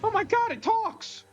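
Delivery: high, drawn-out astonished exclamation; startled. Acted. Captive hears the creature talk, startled. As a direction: gasp it out in high-pitched shock.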